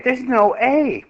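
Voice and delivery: high, slow, dopey voice; mock dim student. Teacher mocking a confused student who misses the A. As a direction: play the clueless kid who can't see it. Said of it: "somebody who's not that smart might say".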